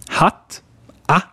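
hatt, then a, both with a brief open 'a'; short A. Shown as a demonstration. Teacher letting learners hear the difference, short A in hatt. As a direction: cut the vowel of hatt and a short, forward in the mouth. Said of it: "a short one".

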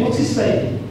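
ordinary talk, nothing marked

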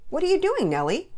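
high, loud playful question; loud, curious dad; father greeting daughter. A narrator's reading. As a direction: hail a child from the doorway.

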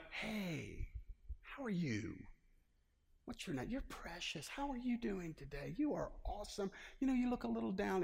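high, soft, cooing small talk; mock sweet niceties. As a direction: greet them in a soft, high, sugary voice. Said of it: "none of the niceties".

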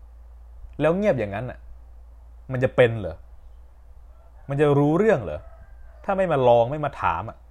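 high, loud scolding, slow start; scolding teacher. Retold or imitated. Teacher scolding a silent pupil, loud rising reproach.

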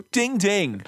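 mock boxing bell, sung out high; ding it like a ring bell; playful bell imitation. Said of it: "play a boxing bell".

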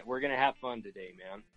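ordinary delivery

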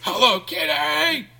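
high, loud, drawn-out squeal; hammed-up scream with the last word stretched out; mock scream. Sorority girl releasing her inner animal, mock scream.

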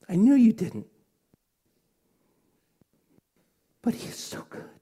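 high, tearful retelling, breaking into whisper; choked up. Real, not acted. Pastor quoting the girl he once counselled, choked up. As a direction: push her words out through real tears. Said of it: "I'm still choked up".

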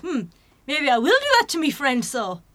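put-on musing half-hearted Scottish accent, plotting aloud. Host voicing Sick Boy plotting, mock Scottish accent. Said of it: "That's my attempt at a Scottish accent".

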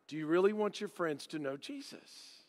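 soft, low, hushed and intimate. Retold or imitated. Youth minister pleading with campers, soft and hushed. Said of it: "And he'd say it like that".

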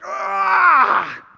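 high, loud exasperated yell; sudden frustrated "ah" yell; frustrated cry. Retold or imitated. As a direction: let out a fed-up shout. Acting out frustration, "you're like.

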